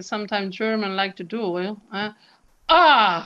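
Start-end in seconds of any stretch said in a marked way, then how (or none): 2.7-3.3 loud pressed glottal ah; hard glottal attack. Shown as a demonstration. Teacher showing aggressive glottal, loud pressed ah. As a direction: slam the vowel shut then open. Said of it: "super glottal".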